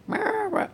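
very high little meows; cat meowing. Retold or imitated. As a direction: squeak out two cat meows.

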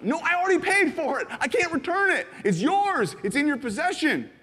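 high, loud pleading; urgent insistence. Retold or imitated. Giver insisting the gift is paid for, high and loud. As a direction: press the gift on them.

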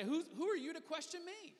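high-pitched, mildly indignant challenge; bristling a little. Pretend. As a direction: act affronted at being questioned. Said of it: "bristle up a little bit".

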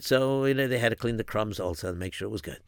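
usual speaking voice

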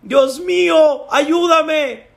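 high, slow, drawn-out cry for help; desperate shouting. Acted. Climber hanging at night cries to God, shouting. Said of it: "empezó a gritar".

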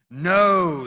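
commanding king's loud no; firm refusal. Acted. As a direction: cut them off with one loud word. King rebukes his men.

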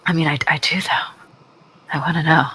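hushed whisper; conspiratorial whisper. Acted. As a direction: lean in and whisper it. Whispering back to spooky answer.

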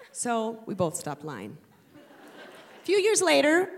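usual speaking voice

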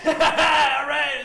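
high, loud whooping shout; laughing shout. Acted. Laughing shout after intro.

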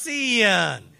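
"sin" drawn out as "see-yun", loud and slow; loud, exaggerated "sin" said as "see-yun"; two-syllable Southern sin. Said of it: "He put two syllables in the word sin".

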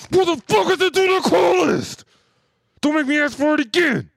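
high, loud hammed-up stickup yell; mock robber shout. Pretend robber storms a building demanding the list, shouting. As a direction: bellow it like a masked robber demanding loot. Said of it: "Ran into somebody's building and said".

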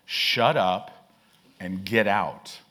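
low, firm, slow command; stern rebuke. Acted. Eve rebukes the serpent, low firm command. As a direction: order the serpent out with authority.

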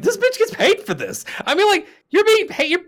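very high-pitched retold disbelief; incredulous indignation. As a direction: squeak upward in mock outrage. Retelling own disbelief at waitress.

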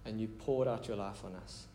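quiet, intimate prayer leading. Real, not acted. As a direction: pray aloud gently and earnestly, voice dropping at phrase ends. Leads the congregation in prayer.

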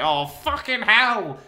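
slow, drawn-out exasperated curse; exasperated Doris. Retold or imitated. Doris fed up with the police, slow exasperated curse. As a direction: let the frustration out slowly. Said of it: "And Doris is like".

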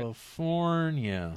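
slow, drawn-out California. Pretend. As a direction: stretch out California slowly. Drawn out mock pronunciation.

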